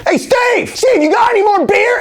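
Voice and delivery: high, loud heckling for a beer; mock calling out. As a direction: imitate a relative hollering across the room. Imitates Betancourt relative yelling to Vai.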